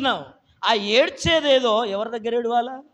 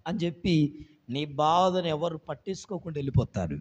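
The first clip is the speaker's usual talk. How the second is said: usual speaking voice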